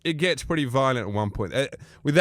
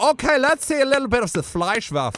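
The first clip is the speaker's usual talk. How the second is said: much higher coaxing Austrian voice; Bruno voice; 8 semitones higher than usual. Retold or imitated. Bruno coaxing the wrestlers, in a high coaxing voice. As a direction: wheedle like a flirty Austrian. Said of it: "I go".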